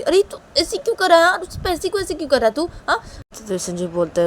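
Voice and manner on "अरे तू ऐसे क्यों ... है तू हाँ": high-pitched, insistent questioning; agitated scolding mother. Acted. मां बेटे को टोकती है. (ऊँचे सुर में टोकती माँ)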